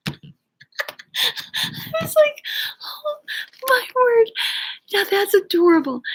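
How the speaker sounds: very high, laughter-choked voice; laughing while talking. Real, not acted. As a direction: squeeze the words out through real laughter. Cracks up retelling husband's excuse.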